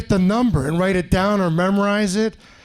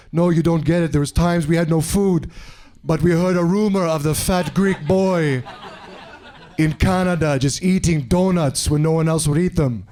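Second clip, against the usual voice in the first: lower, earnest mock African accent; put-on African accent; 3 semitones lower than usual. African stranger thanks his supposed benefactor, put-on African accent. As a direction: thank him solemnly in a vague African accent. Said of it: "what part of Africa that accent from".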